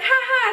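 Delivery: high, hollow jokey laugh; fake laugh. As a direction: laugh it off falsely. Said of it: "they jokingly laugh".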